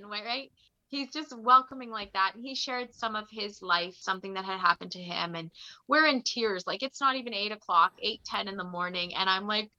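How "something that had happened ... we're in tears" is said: ordinary talk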